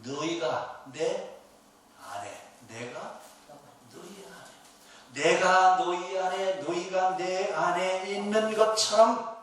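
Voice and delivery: slow scripture recitation. Acted. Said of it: "이렇게 얘기하시죠".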